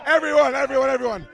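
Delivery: loud call to everyone; shouting to crowd. Real, not acted. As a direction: call out over the noise. Calling crowd to attention.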